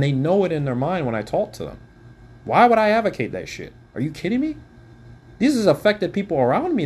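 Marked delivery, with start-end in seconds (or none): 2.4-6.8 outraged, pitched up high; angry. Real, not acted. Vlogger confronting the man who slandered him, outraged. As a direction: demand an answer in disbelief. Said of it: "I'm very angry right now".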